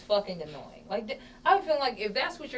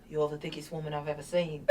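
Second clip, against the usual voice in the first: lower, softer attempt at a British accent; bad British accent; 7 semitones lower, 10 dB quieter than usual. Comedian doing the Englishman's line in a bad British accent. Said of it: "But in the British accent".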